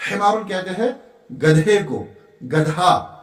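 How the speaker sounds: ordinary delivery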